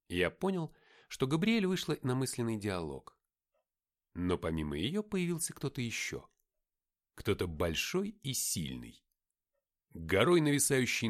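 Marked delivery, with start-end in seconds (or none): none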